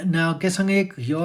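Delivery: usual speaking voice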